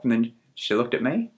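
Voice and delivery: usual speaking voice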